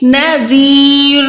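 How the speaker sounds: नज़ीर slow ending on a heavy still र; heavy ra stopped. Shown as a demonstration. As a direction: close नज़ीर on a thick र. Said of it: "क्या हम करेंगे?". (मोटी रॉ के साथ नज़ीर)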